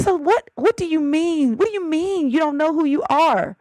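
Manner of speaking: high-pitched imitation of a baffled dad; mock incredulous. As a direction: question it in exaggerated disbelief. Imitates laughing dad questioning crying child.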